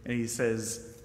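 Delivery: ordinary talk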